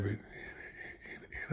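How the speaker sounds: losing your voice mid-sentence; hoarse voice. Real, not acted. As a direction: voice giving out, slightly hoarse. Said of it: "losing my voice".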